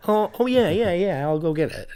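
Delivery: high startled oh yeah, then trailing; suddenly remembers, flustered; sudden realization. Retold or imitated. Imitating waitress realizing.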